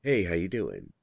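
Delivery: lightly voiced low-key greeting; a hint of a laid-back guy arriving on set; stunt performer impression. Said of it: "the guy comes on and it's like".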